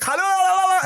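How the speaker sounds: very high, loud babbling la-la; mock babble of someone faking tongues; imitated speaking in tongues. Son imitates mom praying in tongues, high mock babble. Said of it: "try to speak in tongues".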